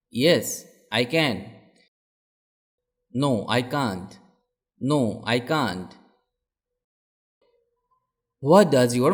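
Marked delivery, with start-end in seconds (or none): none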